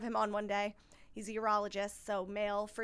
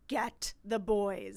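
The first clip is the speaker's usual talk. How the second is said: husband calling the in-laws; 2 semitones lower, much slower than usual. Retold or imitated. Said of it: "was like".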